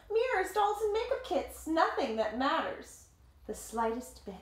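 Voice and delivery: story narration. A narrator's reading. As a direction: tell it like a picture book to kids. Reading the picture book aloud.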